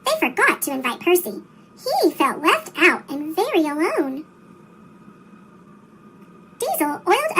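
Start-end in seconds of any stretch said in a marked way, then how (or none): none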